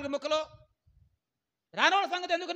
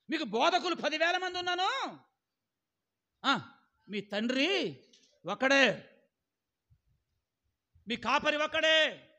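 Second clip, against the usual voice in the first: loud, slow emphatic sermon lines; full-force preaching; 7 dB louder, slower than usual. Acted. Pastor driving home one church, one shepherd, preaching loudly. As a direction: hammer each phrase home from the pulpit.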